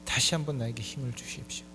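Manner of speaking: low, hushed acted plea; Samson's earnest prayer. Blinded Samson praying to God between the pillars, quietly earnest. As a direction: pray softly, asking for one last chance. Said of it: "하나님 앞에 간절히 기도합니다".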